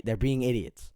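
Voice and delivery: ordinary delivery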